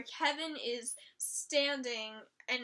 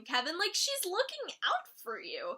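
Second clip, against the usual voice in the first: ordinary delivery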